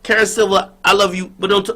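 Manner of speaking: normal talking voice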